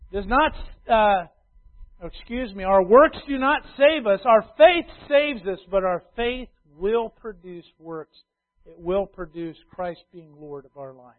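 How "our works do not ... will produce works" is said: full-voiced preaching refrain; preaching at full force. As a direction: drive each line home to the church. Key doctrinal point.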